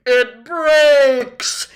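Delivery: loud, high, ecstatic cry; joyful gasp. A narrator's reading. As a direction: burst out with the answer in bliss. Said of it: "he gasped joyfully".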